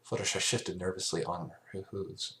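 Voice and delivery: plain reading voice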